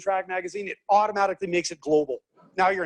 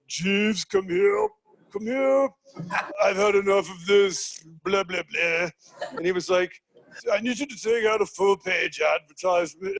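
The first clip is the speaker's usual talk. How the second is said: posh CEO voice. Retold or imitated. Bentley CEO ordering his butler, haughty posh. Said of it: "CEO of Bentley is like".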